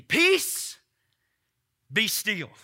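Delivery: high, loud single command; commanding shout. Acted. Jesus commanding the storm, a sudden loud call.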